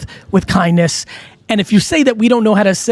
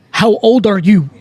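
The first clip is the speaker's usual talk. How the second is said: mock-menacing Russian accent; Ivan Drago Russian accent. Host showing how an accent would help him, mock Russian accent. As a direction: put on a thick Russian accent. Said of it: "Ivan Drago-like accent".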